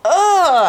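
loud high strained "Ugh!"; straining grunt. Retold or imitated. Mother in labour straining, loud high grunt. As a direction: push out a sharp effortful grunt. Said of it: "knowing that I was like".

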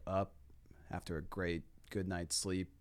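ordinary delivery

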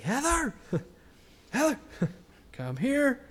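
loud, high excited call across the house; calling out excitedly. Retold or imitated. Husband excitedly calls his wife over to see the post, high loud calling out. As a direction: shout her name to get her in here now.

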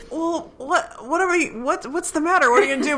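high, stammering questions; imitating a flustered character. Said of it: "he's always have to be like".

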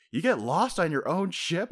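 high, slow mock disbelief; Franky, incredulous. Franky questioning lost Zoro, high mock incredulity.

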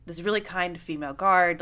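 usual speaking voice

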